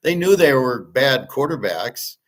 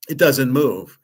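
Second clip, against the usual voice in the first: louder, put-on voice of a fussy coworker; mocking the lady at work; 3 semitones higher, 5 dB louder than usual. Mimicking the lady at work, a bit louder. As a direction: parrot her like a know-it-all colleague. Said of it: "Just like the lady at work".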